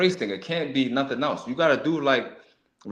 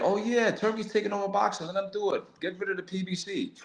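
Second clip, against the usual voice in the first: higher, dismissive mimic of fans; mocking fans; 7 semitones higher, 5 dB quieter than usual. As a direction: parody the fans' easy approval. Mocking boxing fans' praise.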